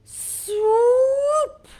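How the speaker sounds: high, loud swooping cry. Acted. Showing kids a bird swooping, high and loud. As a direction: sweep the word up like a diving bird.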